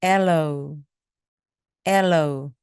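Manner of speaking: ALOE punched on the opening "AL"; stress on first syllable. Shown as a demonstration. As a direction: demonstrate ALOE front-stressed, falling away after it. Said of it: "앞을 강하게 해 주시기 바랍니다". (aloe 앞부분에 강세를 주어)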